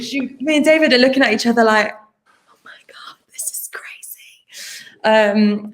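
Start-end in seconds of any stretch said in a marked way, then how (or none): 1.9-5.0 unvoiced, slow hushed whisper; whispered aside to a castmate. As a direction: whisper it in awe to a friend. Excited look between actors.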